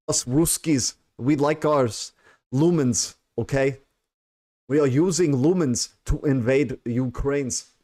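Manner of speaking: hammed-up Russian accent that slides toward Scottish; mock Russian accent. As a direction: boast as a cartoon Russian. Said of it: "Scottish, but okay".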